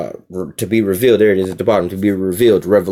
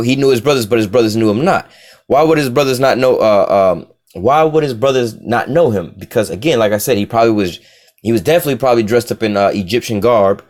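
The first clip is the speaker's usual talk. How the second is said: normal talking voice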